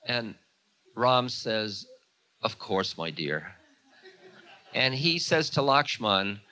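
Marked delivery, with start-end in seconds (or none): 2.4-3.5 a gentle, devoted yes to his wife; tender reply. Acted. Ram answers Sita's request as a tender, doting husband.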